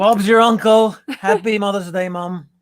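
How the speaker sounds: loud, high hammed-up English accent. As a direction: ham up a British accent. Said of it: "your best English accent".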